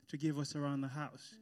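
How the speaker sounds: normal talking voice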